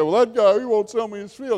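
high, loud mock whine; moping complaint. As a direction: imitate a sulking complainer. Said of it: "he's moping there".

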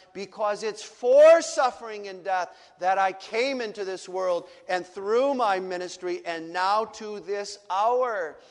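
ordinary talk

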